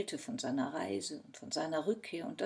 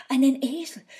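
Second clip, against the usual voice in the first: loud, higher-pitched excited announcement; 5 semitones higher, 14 dB louder, faster than usual. A narrator's reading. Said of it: "ganz aufgeregt". (ganz aufgeregt)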